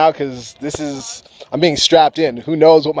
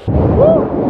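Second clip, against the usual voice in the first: high loud whoop of delight; joyful whoop; 4 dB louder than usual. Real, not acted. Flier to viewers, a high whooping cheer. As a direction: let out a big happy cheer.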